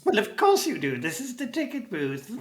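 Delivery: put-on high-pitched old goblin voice; elderly goblin. Goblin answers customers at the ticket booth, high elderly goblin. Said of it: "there is an elderly goblin".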